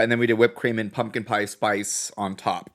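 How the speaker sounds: ordinary talk, nothing marked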